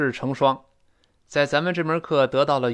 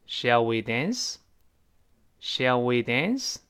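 slow model phrase; much slower than usual. Shown as a demonstration. Teacher repeats model phrase.

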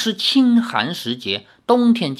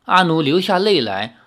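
usual speaking voice

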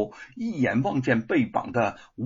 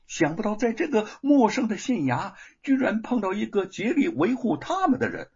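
ordinary delivery, nothing marked

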